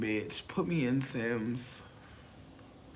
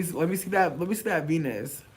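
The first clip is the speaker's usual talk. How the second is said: ordinary talk, nothing marked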